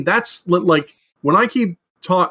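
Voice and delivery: ordinary talk, nothing marked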